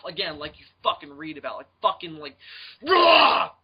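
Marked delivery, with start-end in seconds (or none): 2.9-3.5 sudden high, loud mock roar showing the angry dad's face. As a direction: bark out a cartoonish angry "Rah!". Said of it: "That kind of face".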